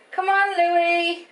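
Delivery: high, slow call to the dog. Real, not acted. Owner coaxing the dog Louis upstairs, high drawn-out call. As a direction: coax him up the stairs.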